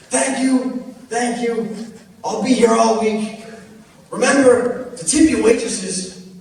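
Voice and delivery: high, loud stand-up sign-off; milk the crowd like a lounge comic; hammed-up comedian. Drunk guy bowing out to the crowd like a comic.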